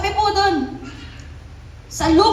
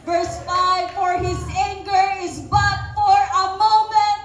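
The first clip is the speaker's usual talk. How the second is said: slow, ringing scripture proclamation, climbing higher; preaching scripture; 4 semitones higher, 3 dB louder than usual. Acted. Preacher proclaims the psalm to the congregation, rising fervent declamation. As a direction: proclaim the psalm, stretching words and building upward.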